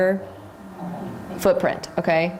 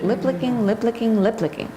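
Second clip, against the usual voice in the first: lip licking said three times quickly; tongue twister three times; 3 semitones higher than usual. Shown as a demonstration. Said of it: "Everybody say that three times fast".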